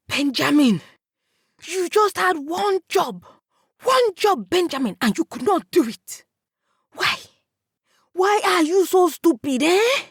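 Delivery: high furious scolding; angry shouting. A narrator's reading. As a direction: yell at him, disbelieving and furious. Shadrach scolding brother in rage.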